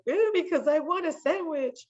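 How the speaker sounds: put-on high whining child voice; whiny kid. Crying daughter answering her dad, whiny. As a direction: whine for the sandwich. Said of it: "And I'm like".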